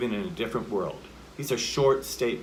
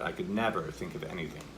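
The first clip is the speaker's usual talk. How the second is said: softer, lower, leaning on "never"; push into "never"; 6 semitones lower, 8 dB quieter, faster than usual. Shown as a demonstration. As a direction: press gently into "never". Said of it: "more of a push into it".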